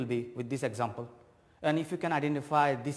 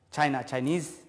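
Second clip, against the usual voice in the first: ordinary talk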